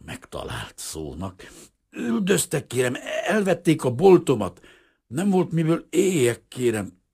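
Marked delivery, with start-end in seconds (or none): 1.9-7.0 nervous pleading. A narrator's reading. Refugee pleading to official.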